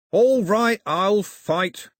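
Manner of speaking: loud, high snarled acceptance; irritated snarl. A narrator's reading. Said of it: "Cox snarled".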